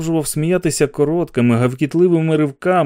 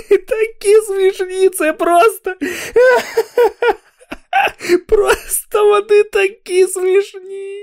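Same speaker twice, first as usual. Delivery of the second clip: very high, slow, loud cry-laughing; hysterical, can't stop laughing; sobbing laughter, almost weeping; 5 dB louder, slower than usual. Acted. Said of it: "ледь не плакав від сміху".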